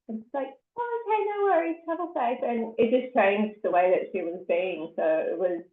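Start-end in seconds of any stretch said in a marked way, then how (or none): 0.8-2.4 high, light take-off of mum; imitating mum's goodbye. Daughter retelling mum's travel-safe goodbye, high imitated mum. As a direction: lift it high for mum's easygoing send-off.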